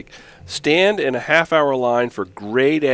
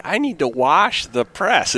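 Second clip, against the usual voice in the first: mock clueless camper; 7 semitones higher, 3 dB louder than usual.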